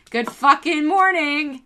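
slow, loud, high cheery shout; loud greeting. Retold or imitated. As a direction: belt out the greeting. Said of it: "and I'm like".

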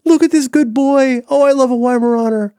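high, loud delighted gush; gushing over a dog. Real, not acted. Showing Dan a Weimaraner photo, high delighted gush.

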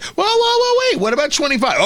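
high, hammed-up whoa of fake alarm; mock outrage. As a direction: jump in high like a politician raising the bid. Acting out Democrats upping the demand.

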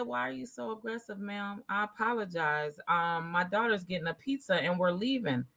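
imitating a quiet, respectful answer to a cop; meek reply. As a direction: keep it soft and careful, not to provoke. Retold own reply to officer.

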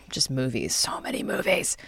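whispered aside, unvoiced and soft; hushed whisper. Real, not acted. To her co-host about a friend's torrents, whispering. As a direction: drop to a conspiratorial whisper.

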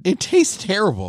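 lively burger debate; heated. Real, not acted. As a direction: defend it, pitch up.

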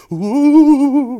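high, loud mock-ghost "ooh"; spooky ghost wail. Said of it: "use ghost 'cause we think".

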